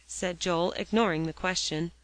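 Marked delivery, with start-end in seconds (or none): none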